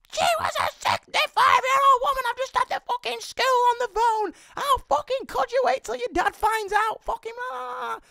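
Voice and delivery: very high screeching phone tirade; loud, piercing mock rant trailing into gibberish; furious mum. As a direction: shriek it like an enraged mother.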